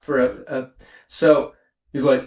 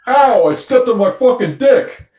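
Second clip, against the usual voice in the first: higher, louder mock yell of pain; hammed-up cry of hurt; 8 semitones higher, 7 dB louder than usual. Husband in a joke ad yelps in mock pain. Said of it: "And he's like".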